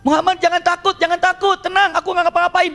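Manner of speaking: high, loud cry for safe passage; imitated frantic shouting. Suraqah calls to Muhammad for safety, shouting high. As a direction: call out high and loud, as if to someone ahead. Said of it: "Maka dia teriak-teriak".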